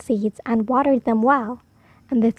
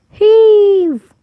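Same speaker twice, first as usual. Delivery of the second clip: 1 big heave; 7 semitones higher, 9 dB louder than usual. Acted. Everyone pulling the turnip.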